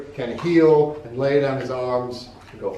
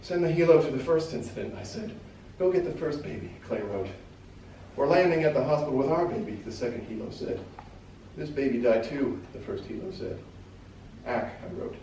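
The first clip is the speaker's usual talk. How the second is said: hushed, fading reading; 2 semitones higher, 4 dB quieter than usual. A narrator's reading. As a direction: let the voice sink as the horror lands. Reading fades after babies die.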